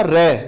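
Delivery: "रे" बोलें, जीभ को र पर हल्का सा कंपाते हुए; "रे" का र थरथराता हुआ साफ़ बोलें; trilled r demo. Shown as a demonstration. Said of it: "जब मैं रे बोलता हूं ना". ("रे" में प्रकंपित र का उदाहरण)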